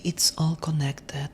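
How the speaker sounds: ordinary talk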